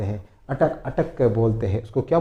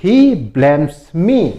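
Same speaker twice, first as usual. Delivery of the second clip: slow model phrase; 3 semitones higher, 5 dB louder, much slower than usual. Shown as a demonstration. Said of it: "तो वह मुझे दोष देता है".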